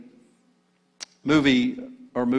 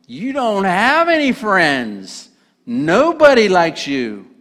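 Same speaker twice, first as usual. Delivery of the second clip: Gollum taunting; 6 semitones higher, 7 dB louder, slower than usual. Acted.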